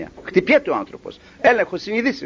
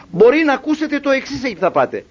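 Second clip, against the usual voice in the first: usual speaking voice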